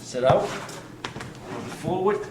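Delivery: slow Boston "forward", r dropped like "fawwad"; jokey Boston accent on "forward". Shown as a demonstration. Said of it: "And that's forward with a Boston accent".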